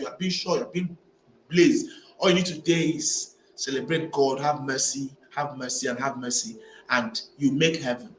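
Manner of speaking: ordinary talk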